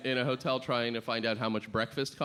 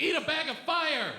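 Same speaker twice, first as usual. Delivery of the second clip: excited tough dare; 13 semitones higher than usual. Retold or imitated.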